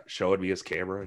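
ordinary talk, nothing marked

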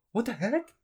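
very high incredulous question; mock bewildered. Woman retelling reaction to being called a leftist, bewildered. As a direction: squeak up in baffled disbelief. Retold reaction to suitor's accusation.

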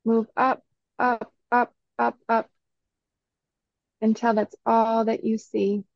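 usual speaking voice

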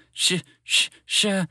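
stuck stuttering on "sh"; panicked stammer. A narrator's reading. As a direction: try to get the word out in fright. Spaceship panics at approaching shadow.

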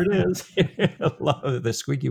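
real laugh breaking into the words; tickled, chuckle as you talk. Real, not acted. Co-host enjoying the squeaky voice policeman, laughing.

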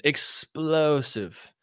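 Explosive stretched out very slow; dramatic reread. Acted. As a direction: drag out Explosive like a trailer voice. Rereads headline word dramatically.

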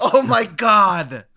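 loud, high, stretched-out exclamation; long, soaring cry of amazement; shocked outcry. Real, not acted. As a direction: shout in horrified disbelief. Horrified at exploding head.

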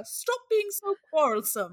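high, loud mock British scolding; hammed-up British parent telling off children; British accent. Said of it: "I'll say it in a British accent".

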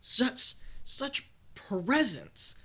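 breathy, slow, reverent hush; awed whisper. Acted. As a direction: savor the word in awe. Awestruck pause.

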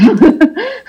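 high, embarrassed giggle; nervous laugh. Real, not acted. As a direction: laugh it off, a bit awkward. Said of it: "sorry, it's just a nervous laugh".

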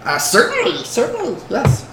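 high-pitched, eager creature voice; Gollum. Acted. Goblin lets customer inspect cloth, Gollum voice. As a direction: agree in a small high creature voice. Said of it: "I should do Gollum voice".

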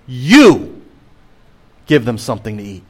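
Jesus's command with the weight on "You"; stress on YOU. Shown as a demonstration. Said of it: "the emphasis in the Greek is on the word "you".

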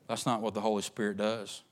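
usual speaking voice

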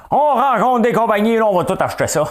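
very high, loud put-on voice; mock quote of a companion. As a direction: blare it out in a silly high voice. Mocking retold answer of Alexandre.

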